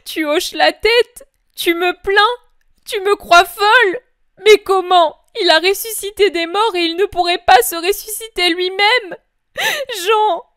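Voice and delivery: a mother refusing to believe her son is gone; heightened voice of the grieving mother; grieving Mary. Acted.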